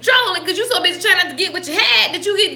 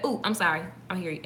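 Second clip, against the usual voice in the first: soft apology; 8 semitones lower, 12 dB quieter, faster than usual. Retold or imitated. Retold quick apology.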